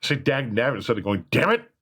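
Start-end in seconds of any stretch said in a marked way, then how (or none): 1.3-1.7 mock curse. Quoting a swear as example.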